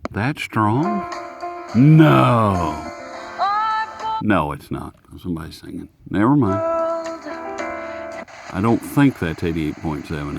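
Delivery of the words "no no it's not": usual speaking voice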